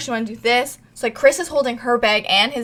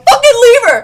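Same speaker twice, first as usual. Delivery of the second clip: much higher, louder mock shout; 14 semitones higher, 12 dB louder than usual. Teller retells urging the group to abandon Selena, shouting. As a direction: blurt it out fed up. Heated argument about leaving friend.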